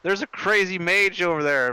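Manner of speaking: loud, high cry of alarm; shouted false alarm. Acted. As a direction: call it out to distract the crowd. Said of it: "and shout and be like".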